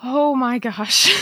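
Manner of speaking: drawn out, on the verge of happy tears; overwhelmed with emotion. Real, not acted. Bride hearing fiancé's message, slow tearful gasp. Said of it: "I'm in a puddle on the floor".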